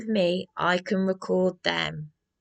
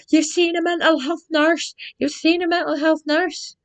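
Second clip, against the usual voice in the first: higher and louder put-on yell at her; mock shouting desk man; 9 semitones higher, 5 dB louder than usual. Jim on the desk yelling at her, hammed-up shout. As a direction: bellow it in a caricature voice. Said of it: "screaming and shouting at me".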